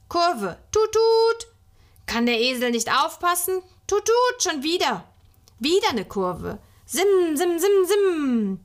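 high, loud make-believe driving with toots; excited pretend motorist, pitched well up; child playing driver. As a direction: play the racing driver, honking and zooming. Child imitates horn and engine.

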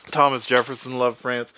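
ordinary talk

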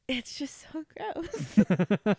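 slow, disgusted laugh; grossed out, laughing through it. Real, not acted. After shooting whiskey.

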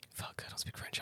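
panicked whisper to himself; whispered, barely voiced realisation; stunned whisper. Customer to himself as the Frenchman talks, stunned whisper.

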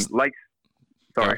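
distant from the microphone; speaking away from the mic; far from mic. Real, not acted. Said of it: "You're far away".

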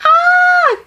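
shrill, very high squawking cries like a gull; mock gull squawk yelling for Mom; seagull screech. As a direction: screech it out like a seagull stealing food. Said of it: "You're gonna hear".

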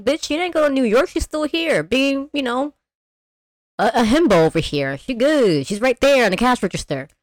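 sassy, high, fast put-down; savage sister. Retold or imitated. Sister mocking Julie's question, sassy high put-down. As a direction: brush her off with sass. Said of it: "her sister was savage".

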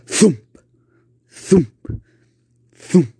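loud vocal thumps; thumping noise. Acted. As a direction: boom out each thump. Thudding noise from basement.

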